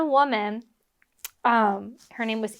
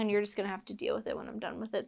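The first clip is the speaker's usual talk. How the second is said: rushed, much softer aside; hurried aside; 2 semitones lower, 12 dB quieter, much faster than usual. Real, not acted. Storyteller brushing off own fumbled line, hurried aside. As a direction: toss it off quickly under the breath.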